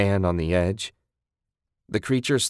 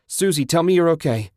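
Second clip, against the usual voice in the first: loud, high-pitched urgent plea; yell, begging for an answer; desperate scream; 9 semitones higher, 6 dB louder than usual. Acted. Man checking his partner survived the attack, screaming. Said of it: "Ted screamed".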